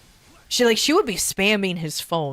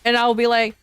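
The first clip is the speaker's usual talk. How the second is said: usual speaking voice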